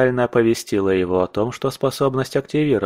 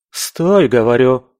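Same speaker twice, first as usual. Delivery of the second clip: shouting; 3 semitones higher, 6 dB louder than usual. Acted. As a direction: yell at him to stop. Elf shouting stop.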